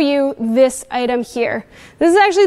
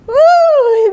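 surprised ooh; 9 semitones higher, 6 dB louder than usual. Real, not acted. Water splashed in his face.

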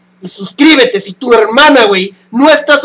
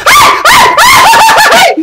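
real scared yelps; 5 dB louder, slower than usual. Real, not acted. Jump scare while playing.

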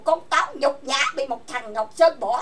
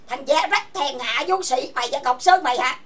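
normal talking voice

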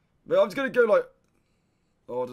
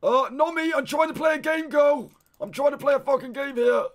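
louder, higher exasperated retort; annoyed outburst; 5 semitones higher, 4 dB louder than usual. Real, not acted. Streamer snapping at a trolling donation, annoyed and louder. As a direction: push back, irritated, raising the voice.

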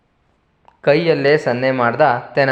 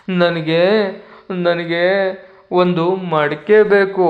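put-on slow, higher little child asking; whiny child; 4 semitones higher, slower than usual. Tenali as a child demanding a pot from the king, slow and whiny. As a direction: stretch it out like a pouting kid. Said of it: "ನಾನು ಆ ಮಗುವಿನ ಹಾಗೆ ಇಲ್ಲಿ ನಟಿಸ್ತೀನಿ".

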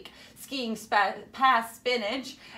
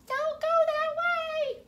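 very high, tiny, squeaky warning yell; a little chickpea calling out in alarm; 4 dB louder than usual. A narrator's reading. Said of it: "yelled a chickpea to warn her".

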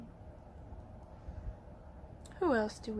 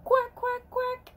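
happy duck quacking; 10 semitones higher than usual. Acted. Ducky showing children how happy sounds, high quacking. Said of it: "Everybody give me a".

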